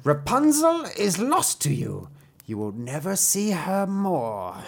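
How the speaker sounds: high, gloating witchy villainess; mocking. A narrator's reading. Enchantress taunting the king's son over Rapunzel, high mocking cry. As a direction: pronounce the doom with relish. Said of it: "she cried mockingly".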